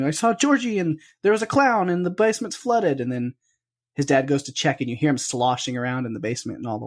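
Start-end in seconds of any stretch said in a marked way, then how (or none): 0.0-3.0 put-on higher, urgent little boy blurting it out; scared kid. Retelling Bill telling his dad about the basement, higher kid voice. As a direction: rush it out like a frightened child. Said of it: "him going".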